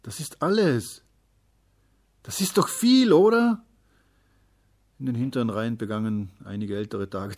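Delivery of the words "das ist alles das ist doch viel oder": put-on high, loud child pleading for approval; dismayed little one, voice pitched way up; bewildered protest. A narrator's reading. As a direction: protest upward, ending in an insistent question. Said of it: "stammelte er".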